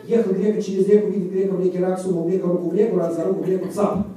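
quick-fire tongue-twister run. Shown as a demonstration. Showing listeners the twister about Grega, quick-fire. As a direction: rattle it off in one fast stream. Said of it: "the next tongue twister".

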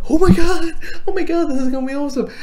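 high, loud fangirl-style squeal of excitement; thrilled fan reaction. Retold or imitated. Fan reacting to Batman's comeback, thrilled. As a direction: burst out high, then rush the rest.

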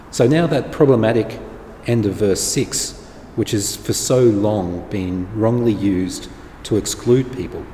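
ordinary talk

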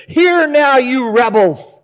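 high, loud furious rant at the people; angry Moses yelling. Retold or imitated. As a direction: blow up at the rebels. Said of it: "he just loses it".

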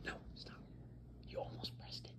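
hushed urgent whisper. Real, not acted. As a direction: whisper it so the mic barely hears. Whispering aside off-mic.

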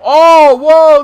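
high, loud gasping whoa; startled shout. Real, not acted. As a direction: blurt it out in shock. Sudden big move in game.

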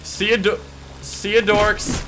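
high, loud, slow taunt. Acted. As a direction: drag it out to mock them. Taunting while driving away.

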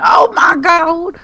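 slow, high, shouted mock panic; hammed-up panicking mom yelling; panicked mom. Mom running from exploding washer.

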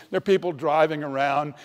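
normal talking voice

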